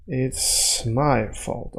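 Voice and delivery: stretched, soft teaching translation; slow demo. Shown as a demonstration. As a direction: spell it out slowly and quietly. Translates slowly word by word.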